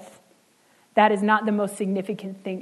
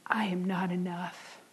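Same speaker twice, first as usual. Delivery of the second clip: slow, unvoiced whispered confession; a hushed whisper of someone feeling worthless; 7 dB quieter than usual. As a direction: breathe it out as a broken admission. Voicing a broken person's confession.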